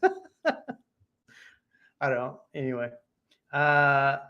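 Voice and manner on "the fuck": baffled. Retold or imitated. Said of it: "he's just like".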